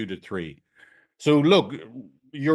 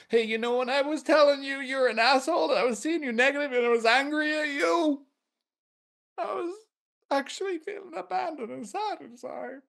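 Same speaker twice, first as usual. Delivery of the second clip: acted vulnerable client. Shown as a demonstration. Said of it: "You help them now go".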